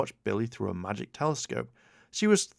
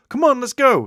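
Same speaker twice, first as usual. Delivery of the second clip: much higher and louder excited shout; 13 dB louder than usual. A narrator's reading. Teen shouting to friends at the shoreline. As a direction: call the others to the water. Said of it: "he shouted".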